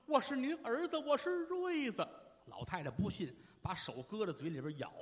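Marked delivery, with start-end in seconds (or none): 0.1-2.1 pleading son, pitched well up; desperate insistence. Acted.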